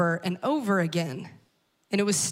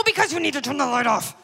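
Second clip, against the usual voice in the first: exasperated retort; 2 semitones higher, faster than usual. Retold or imitated. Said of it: "And I go".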